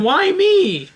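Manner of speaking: high, whiny mock wail; mock despair. Mock lament after the story.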